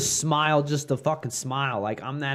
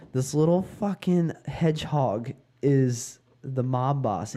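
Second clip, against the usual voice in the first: ordinary talk, nothing marked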